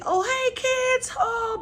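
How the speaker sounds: hammed-up cheery kids' show voice; goofy TV host. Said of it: "being silly and goofy".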